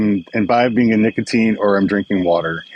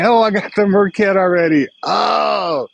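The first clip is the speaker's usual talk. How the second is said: excited squeal; 11 semitones higher than usual. Real, not acted. Surprise gift arrives on stream.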